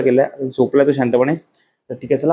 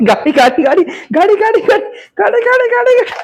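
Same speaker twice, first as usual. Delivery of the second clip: rising, excited high-pitched chant; squeaky play voice climbing upward; playful squealing; 4 dB louder, slower than usual. Real, not acted. Playfully getting sulky child to bed.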